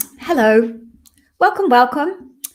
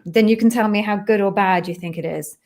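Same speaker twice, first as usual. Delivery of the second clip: ordinary talk, nothing marked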